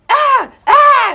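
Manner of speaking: high, loud yelps at a dog; two frantic acted-out yells; mock yell. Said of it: "I'm yelling at the dog".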